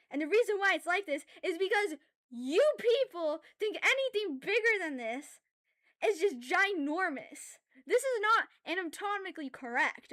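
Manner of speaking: pushing the volume up without being mad. Real, not acted. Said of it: "like, I raised my voice".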